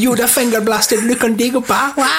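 very high, daft spoiler voice; silly character voice. Pretend. Host spoiling the film as a joke, high silly voice.

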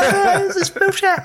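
high hammed-up yell; mock shout. Mocking Anakin's outburst with a high hammed-up yell. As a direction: yell it high, half laughing.

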